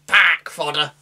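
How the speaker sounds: mock Lancashire soldier's battle line; bad Lancashire accent. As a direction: bark it like a Lancashire pikeman, badly. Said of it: "a really bad attempt at a Lancashire accent".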